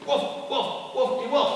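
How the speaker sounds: high, bouncy woofs; high-pitched spoken barking; dog barking. Acted. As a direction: yap playfully like a storybook dog. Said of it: "The dog going".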